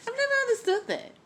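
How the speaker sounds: high, fast "never" in exasperation; exasperated disbelief. Real, not acted. Speaker reacts to Jada's line, exasperated and high.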